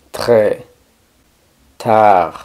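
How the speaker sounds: Très tard, each R gargled in the throat; throaty French R. Shown as a demonstration. Teacher demonstrating French R, Très tard with gargled R. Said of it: "una R que se produce en la garganta".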